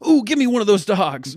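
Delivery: a chatty driver spotting a dog, voice jumps up; excited driver voice. Retold or imitated. Driver spots someone walking dogs and asks eagerly in a high voice. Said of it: "He goes".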